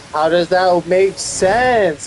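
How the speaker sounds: loud, high, slow disbelief; asking coworkers, loud and slow; exasperated question. Retold or imitated. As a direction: demand an answer, exasperated. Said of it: "And I'm like".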